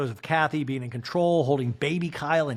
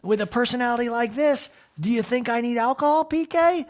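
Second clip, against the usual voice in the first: higher, louder sassy voice; Kyle impression; 10 semitones higher, 4 dB louder than usual. Guest teasing the host, high sassy mock voice. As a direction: toss it off as a cheeky boast. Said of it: "And Kyle's like".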